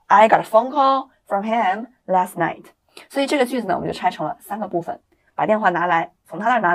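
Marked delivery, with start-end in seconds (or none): 0.1-2.6 slow and a bit louder drill line; slow model sentence. Shown as a demonstration. Teacher drills the sentence for students, slow. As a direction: say it slowly for students to repeat. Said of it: "所以英文我们可以说".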